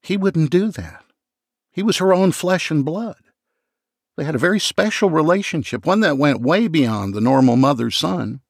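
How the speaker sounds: nervous sister defending her brother; anxious young woman. Acted.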